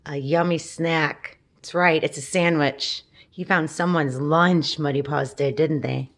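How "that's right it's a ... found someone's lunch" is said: plain reading voice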